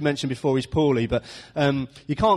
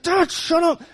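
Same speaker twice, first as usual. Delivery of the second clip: high-pitched mock indignation; exasperated son's retort; 13 semitones higher, 3 dB louder, slower than usual. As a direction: imitate a kid silencing an embarrassing dad. Son retorts to shouting dad.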